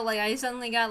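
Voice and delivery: usual speaking voice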